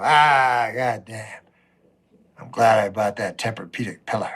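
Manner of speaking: low, sleepy morning groan; groggy waking up. Retold or imitated. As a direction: mumble it like just woken.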